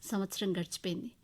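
ordinary delivery, nothing marked

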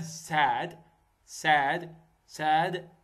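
Sad said slowly with a wide open a; demo of the short vowel. Shown as a demonstration. Teacher models the vowel in Sad for students. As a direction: stretch the a in Sad so learners hear it. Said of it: "الحرف أي بالكلمة sad".

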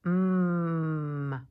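long humming "mm", held loud; m sound. Shown as a demonstration. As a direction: hum "mm" with lips closed. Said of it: "An M says".

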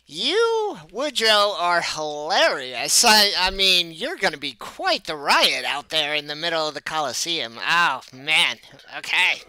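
loud, high, gushing promoter; delighted show manager. Acted. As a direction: gush theatrically over your new act.

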